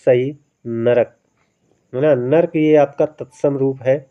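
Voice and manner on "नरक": नरक said slowly with a full middle vowel, na-rak; correct pronunciation. Shown as a demonstration. Teacher slowly modeling the Hindi form नरक for students. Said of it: "तद्भव रूप ही माना जाएगा".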